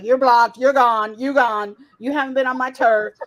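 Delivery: loud imitation of someone's rant; hammed-up angry yelling; mock yelling. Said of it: "then he yells on his own".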